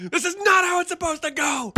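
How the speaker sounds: very high, loud mock sobbing protest; hammed-up bawling like a sore loser; mock crying. Streamer voicing doubters losing it, high mock wail. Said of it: "They're crying".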